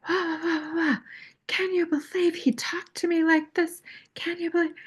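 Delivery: put-on whiny little-girl voice; mock whiny tantrum. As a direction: ham up the crybaby complaint. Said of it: "I was like".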